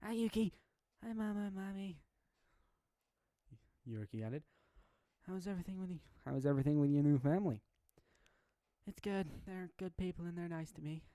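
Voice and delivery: put-on high, bright young child voice; little girl. Yuriki calling her old family, cheerful little girl. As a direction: play the small child on the phone.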